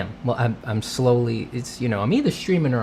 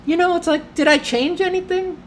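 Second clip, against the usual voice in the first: a much higher, squeaky put-on voice asking itself; mock bewildered falsetto; 3 dB louder than usual. Streamer mocking his own confusion about the stream setup, high squeaky voice. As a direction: play the baffled streamer in a silly high voice.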